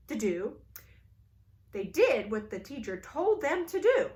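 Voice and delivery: ordinary delivery, nothing marked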